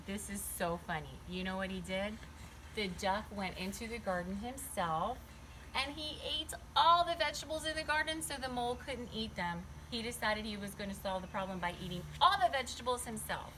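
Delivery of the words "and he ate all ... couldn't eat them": ordinary delivery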